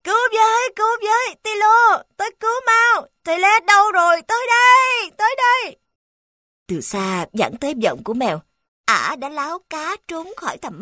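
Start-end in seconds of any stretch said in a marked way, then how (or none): 0.0-5.8 high, loud terrified screams; screaming for help. Acted. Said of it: "kêu toáng lên tìm sự giúp đỡ". (tiếng kêu cứu hoảng loạn)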